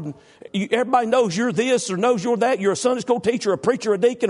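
high, loud preacher shouting his point; full-force preaching. Real, not acted. Preacher exhorting the congregation, shouting high and loud. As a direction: preach it at the top of your voice.

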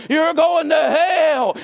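slow, drawn-out hellfire shout, high and loud; imitating a shouting preacher. Mimicking the hellfire preacher to the church, slow shout. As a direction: bellow each word like a damning preacher. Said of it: "it just kept going".

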